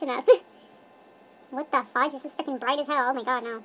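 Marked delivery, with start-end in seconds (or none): none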